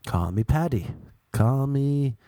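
ordinary talk

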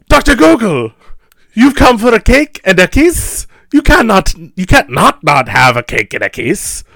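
high, loud gushing crowd; excited party guests. Retold or imitated. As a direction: cheer him on like a giddy fan.

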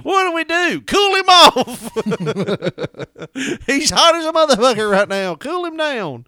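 high, loud mock panic. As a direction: cry out like frantic rescuers. Richard reviving him, mock panic.

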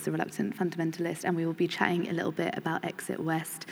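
ordinary delivery

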